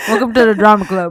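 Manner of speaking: words round a crunchy bite; crunching chips. Real, not acted. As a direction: mouth full of chips. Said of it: "Can you hear me crunching?".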